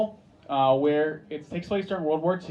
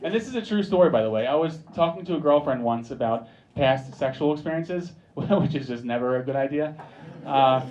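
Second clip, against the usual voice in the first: usual speaking voice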